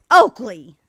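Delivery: sharp loud call of the dog's name; real yell at the dog. Real, not acted. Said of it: "sorry if I just yelled in your ear".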